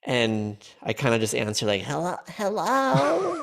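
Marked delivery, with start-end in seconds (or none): 1.8-3.4 put-on high, slow old lady voice; quavering elderly hello, stretched out; grandma voice. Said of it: "I do a version of her voice".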